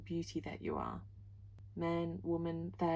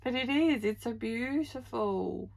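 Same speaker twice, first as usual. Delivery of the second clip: still moved; 4 semitones higher, 6 dB louder than usual. Real, not acted. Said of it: "I could cry".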